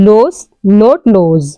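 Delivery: loss said slowly with a clear short vowel, set against lose; pronunciation demo. Shown as a demonstration. Teacher to students, slow pronunciation contrast. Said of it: "the pronunciation is".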